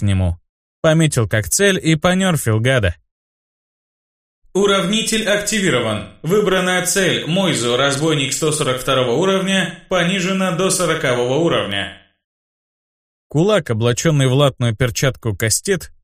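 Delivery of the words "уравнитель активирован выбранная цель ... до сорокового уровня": system announcement. Acted. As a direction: read it like a game notification. Game system message read out.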